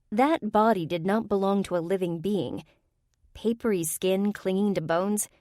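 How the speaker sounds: ordinary delivery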